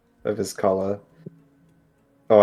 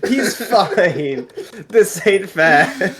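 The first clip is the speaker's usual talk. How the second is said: laughing protest; 10 semitones higher, 4 dB louder than usual. Real, not acted. Player protests the brutal result.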